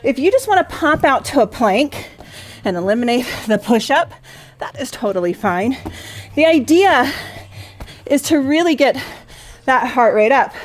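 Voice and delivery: energetic live exercise cues; aerobics instructor. Acted. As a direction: drive them through the set. Cues exercise during interval.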